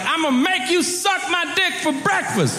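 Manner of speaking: high-pitched imitation of a menacing prisoner; mock inmate threat. Prisoner to middle schoolers, high mock threat. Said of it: "he's talking ridiculous shit to middle schoolers".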